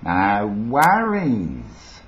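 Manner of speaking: slow, broad Aussie with swooping tune; Australian accent. Acted. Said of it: "Lindy Chamberlain's Australian accent".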